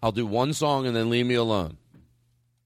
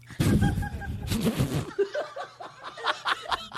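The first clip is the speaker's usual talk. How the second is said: real high, helpless laughing fit; cackling laugh. Real, not acted. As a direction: crack up and keep laughing. Cracks up at the show sucking.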